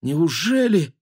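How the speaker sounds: high, incredulous inner question; disbelieving thought. A narrator's reading. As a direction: wonder it in helpless disbelief. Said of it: "проносилось в её мыслях". (недоверчивая мысль)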